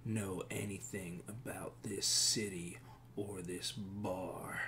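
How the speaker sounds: slow, hushed, whispery menace; surly bar patron. Acted. Bar stranger stonewalls a nosy questioner, whispery and hostile. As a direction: draw it out quietly, under the breath.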